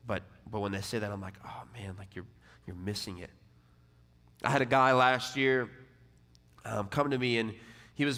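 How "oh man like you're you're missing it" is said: soft, low sigh of concern; gentle counsel. Retold or imitated. Pastor gently telling a proud man he misses it, soft. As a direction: say it softly, like a friend letting him down easy.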